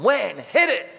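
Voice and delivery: high, loud cheering call; crowd shout. Retold or imitated. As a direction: yell it like a whole prison block egging him on.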